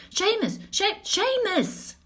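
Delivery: high, urgent call of a name. Acted. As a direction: call his name from far off. Voice calling through the dream.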